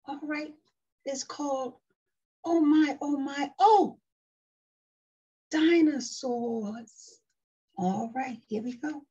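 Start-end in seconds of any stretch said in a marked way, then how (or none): 2.4-4.0 high, loud storybook oh-my; exclaimed book title. Acted. 5.5-7.2 DINOSAURS slow and loud; drawn-out book title. Acted. Reading book title to child.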